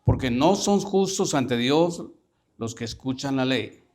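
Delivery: versículo lento, recalcado por partes; proclámalo despacio, alargando el final; relectura enfática. Shown as a demonstration. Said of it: "Y te lo voy a decir así". (lectura enfática y pausada)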